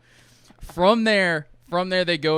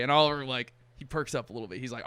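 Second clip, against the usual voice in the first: ordinary delivery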